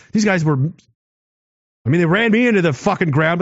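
usual speaking voice

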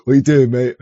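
imitating a London geezer's "What you doing, mate?"; mock London accent. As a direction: ham up a cockney challenge, lightly. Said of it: "You like that?".

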